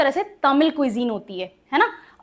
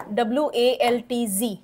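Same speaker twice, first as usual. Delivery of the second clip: slow spelling; slower than usual. Shown as a demonstration. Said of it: "एक और word आपको देती हूँ".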